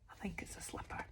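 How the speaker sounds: softly whispered secret; whispered aside. Real, not acted. Reader whispers to children about the slipper picture.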